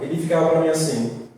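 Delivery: ordinary delivery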